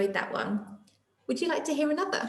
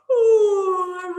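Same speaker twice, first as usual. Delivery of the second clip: loud, high yawn; 9 semitones higher, 7 dB louder than usual. Acted. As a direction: stretch it into a big yawn. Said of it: "Day Monkey yawned".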